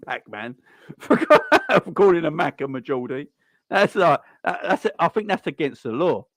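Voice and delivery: laughing through the words. Real, not acted. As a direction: giggle while you explain it. Laughing at the Geordie mix-up.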